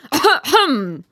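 loud, high "ahem"; angry throat-clearing. A narrator's reading. Rocket unable to get a word in, angry throat-clearing. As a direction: clear the throat sharply to break in. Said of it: "said the rocket angrily".

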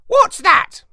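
high, loud squawking parrot. A narrator's reading. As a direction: squawk it out like a startled bird. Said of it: "he squawked".

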